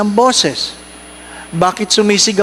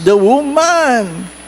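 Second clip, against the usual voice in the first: high, drawn-out accusation; blaming the wife; 5 semitones higher, much slower than usual. Acted. Preacher replays Adam blaming the wife, slow high accusation. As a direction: stretch it out high, pinning it on her. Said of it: "Sinisi si Missus".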